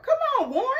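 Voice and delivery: high loud exasperated plea; high, loud exasperation. Real, not acted. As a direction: protest the lecture, outraged. Exasperated at scolding brother.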